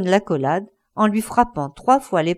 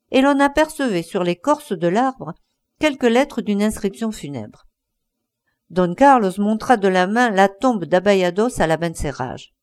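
plain reading voice

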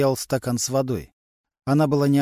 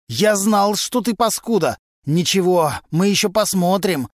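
loud, higher threatening yell; furious yelling; 6 semitones higher, 5 dB louder than usual. A narrator's reading. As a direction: roar threats after the investigator. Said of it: "заорал Глущенко". (яростный угрожающий ор)

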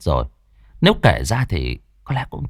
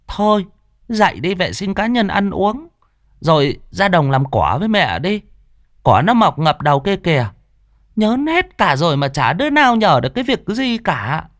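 put-on higher, fast nagging old mother's voice; scolding grandmother; 7 semitones higher than usual. Grandmother scolding her oversleeping daughter, higher and nagging. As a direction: nag like an exasperated old mother.

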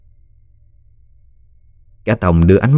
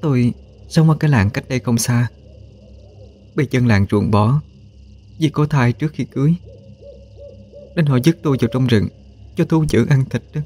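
higher-pitched pleading woman's voice; woman pleading for help; 6 semitones higher, 4 dB quieter than usual. A narrator's reading. Outcast pregnant woman begs Minh for help, pleading. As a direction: plead in a high, softened female voice. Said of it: "cất lời cầu cứu".

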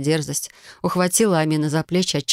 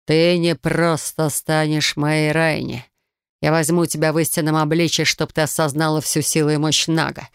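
commanding snake lord. Acted. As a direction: lay down the law like a serpent king. Snake lord threatens the heroine.

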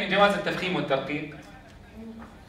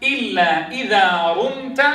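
slow chanted verse إلا إذا رمت; poem recitation; slower than usual. Shown as a demonstration. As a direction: recite the line slowly like verse. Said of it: "قال فيه الإمام الجزري". (إنشاد بيت الجزرية ببطء)

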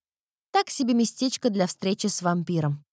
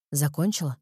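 low dry vampire voice; 6 semitones lower than usual. A narrator's reading. Erik's dry question.

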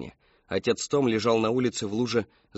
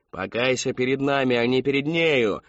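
forceful shout building louder; yelled demand; 3 semitones higher, 4 dB louder than usual. A narrator's reading. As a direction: bellow the command, loudest at the end. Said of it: "заорала миссис Рэдклифф".

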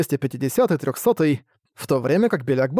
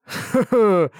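hearty laugh; 6 semitones higher than usual. Acted. Big warrior laughs before entering.

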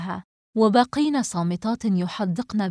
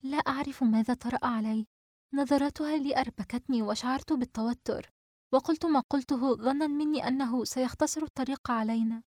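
higher, sheepish apology; embarrassed and regretful; 5 semitones higher, 3 dB quieter than usual. Acted. Najwa excusing her slip to friends, embarrassed and sorry. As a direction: explain yourself with awkward remorse. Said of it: "نجوى بخليط من التحرج والندم".

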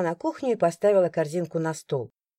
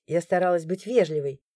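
ordinary delivery

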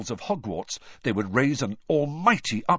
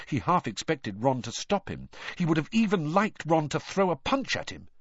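plain reading voice

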